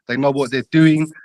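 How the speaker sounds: usual speaking voice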